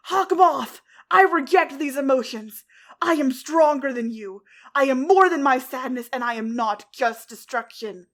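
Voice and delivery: loud, stern shout upward; defiant yell. A narrator's reading. Cat Blanc defying Hawkmoth, a loud stern yell. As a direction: hurl it at the sky. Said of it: "sternly looked toward the heavens and yelled".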